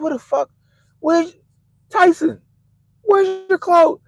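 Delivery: high startled shout at the dog; shocked yell. Retold or imitated. Owner yelling at runaway dog, shocked and high. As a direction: yell in disbelief, pitch way up.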